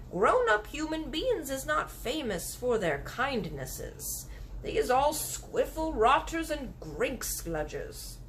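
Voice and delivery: the kindly giant grumbling slowly. Acted. Giant warning the girl about grown-ups, slow. BFG voiced in story.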